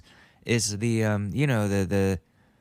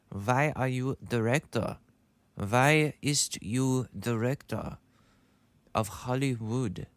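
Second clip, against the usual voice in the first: mock German accent; 2 semitones higher than usual. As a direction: question him in a badly faked German accent. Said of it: "I can't do the German accent".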